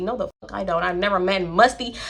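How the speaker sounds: normal talking voice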